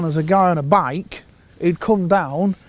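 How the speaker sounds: normal talking voice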